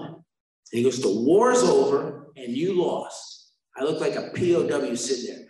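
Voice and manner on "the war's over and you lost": very slow, weighted delivery, starting loud; grave pronouncement. Retold or imitated. Man delivering hard news to a newcomer, slow pronouncement. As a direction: spell out the verdict slowly, with pauses. Retold solemn verdict from the counselor.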